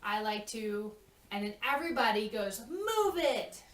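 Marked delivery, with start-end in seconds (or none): 2.7-3.6 yelled. Shown as a demonstration. The lemurs yell from the sides, a high loud shout. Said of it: "but just yells it from this".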